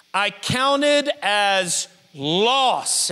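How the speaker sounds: very slow, weighty scripture read; slow deliberate reading. Acted. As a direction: stretch the line out slowly.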